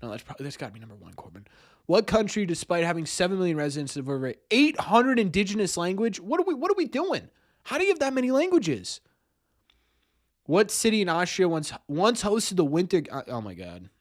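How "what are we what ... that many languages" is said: high-pitched, baffled protest; incredulous. Real, not acted. Streamer baffled by eight hundred languages, incredulous. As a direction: pitch it way up in disbelief. Baffled by absurd quiz question.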